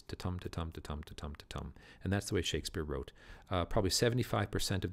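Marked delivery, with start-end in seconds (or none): 0.0-1.7 soft da-DUM beats, weak then strong, fading away; iambic rhythm. Shown as a demonstration. Teacher demonstrating the iamb rhythm to the class, softly chanted. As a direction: tap out ta-tum with the stress on tum. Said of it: "five iambs, five beats".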